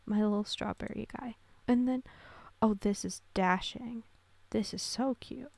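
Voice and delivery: usual speaking voice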